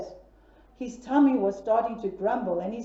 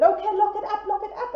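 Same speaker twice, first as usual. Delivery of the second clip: high hurried lock it up; higher and urgent; panicked piggy; 8 semitones higher, 4 dB louder, faster than usual. Acted. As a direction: piglet agreeing in a panic. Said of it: "Piggy number two said".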